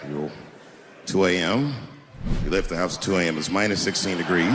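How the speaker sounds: low, tired cop voice; deadpan like a cop who's heard it all; weary veteran cop. Retold or imitated. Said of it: "police veteran taking this kid's police report".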